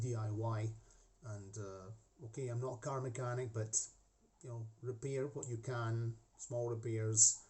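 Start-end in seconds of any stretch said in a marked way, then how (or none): none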